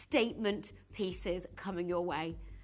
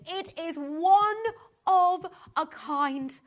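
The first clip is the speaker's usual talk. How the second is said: louder, higher and slow, every word weighted; slow emphatic; 5 semitones higher, 7 dB louder, much slower than usual. Real, not acted. Host telling viewers, slow loud emphasis. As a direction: stress each word like a proclamation.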